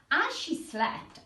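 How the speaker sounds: plain reading voice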